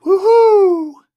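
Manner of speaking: very high, loud, drawn-out whoop; whooping "woohoo". Retold or imitated. The man's heard instruction from God, whooping cheer. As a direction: let out a soaring whoop. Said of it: "Tell him to do a handstand and go".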